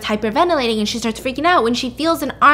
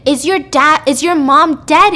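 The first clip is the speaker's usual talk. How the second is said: loud, higher-pitched mock yelling; retold scream; 5 semitones higher, 9 dB louder, slower than usual. She screams at Ethan about his mom, loud retold yell. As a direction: act out a yelled demand, pushing each word hard. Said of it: "she just screams at him".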